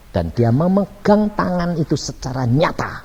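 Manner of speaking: ordinary talk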